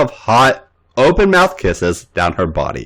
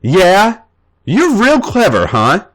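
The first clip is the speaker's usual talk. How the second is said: sardonic; 9 semitones higher than usual. A narrator's reading. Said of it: "A J laughed sardonically".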